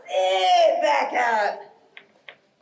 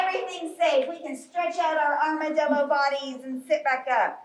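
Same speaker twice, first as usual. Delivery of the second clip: ordinary delivery, nothing marked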